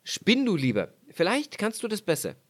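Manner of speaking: leicht höher, knapp urteilend; Mann spricht. Acted. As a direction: ein Hauch von herablassendem Ehemann. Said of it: "sprach der Mann". (Ehemann sieht die wunden Hände, leicht gespielt)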